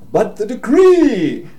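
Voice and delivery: loud, high, drawn-out cry; desperate shout. Acted. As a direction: shout after someone walking away, stretching the last word. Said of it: "he shouts off".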